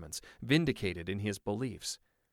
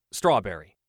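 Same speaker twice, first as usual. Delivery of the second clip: blurted; 9 dB louder than usual. Acted. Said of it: "he blurted".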